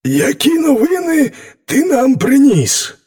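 high-pitched storybook wolf; high, prodding wolf character. A narrator's reading. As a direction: call out to the lion in a high animal voice. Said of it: "першим обізвався вовк".

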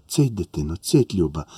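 put-on low, soft shushing of a child; calm her down gently in the dark; aunt quieting a sobbing child at night, soft and low; soothing hush. Aunt hushes crying child at night.

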